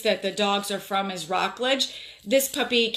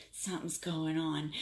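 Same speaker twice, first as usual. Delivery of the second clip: hushed suspicion; 5 semitones lower, 12 dB quieter than usual. Retold or imitated. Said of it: "I was like".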